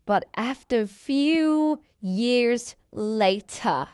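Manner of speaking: stretched-out storytelling line; drawn-out time skip. Acted. Storyteller skipping ahead a few years, slow and drawn out. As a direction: draw each word out slowly, like a caption card.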